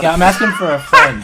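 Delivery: laughing through a sheepish admission. Real, not acted. Guest laughs, owning up to the friend joke. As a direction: chuckle it out, caught and amused.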